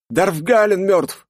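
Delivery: slow high shout from afar. A narrator's reading. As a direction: cry out the death news. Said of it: "слышу крик".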